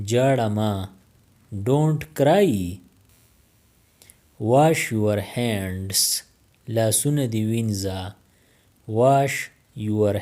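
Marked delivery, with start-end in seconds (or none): 4.4-6.2 each word drawn out slowly; very slow, careful. Shown as a demonstration. Teacher modelling English for Pashto learners, very slow. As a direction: spell it out slowly for the class. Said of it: "teacher repeats the phrase twice".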